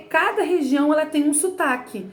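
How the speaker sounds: normal talking voice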